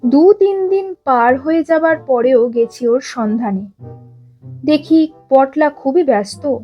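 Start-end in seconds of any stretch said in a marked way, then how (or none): none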